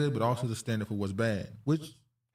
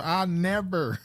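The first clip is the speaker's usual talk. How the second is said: higher-pitched mock Southern drawl; imitated Southern accent; 6 semitones higher, much slower than usual. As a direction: mimic her Southern accent. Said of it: "it's still Southern as hell".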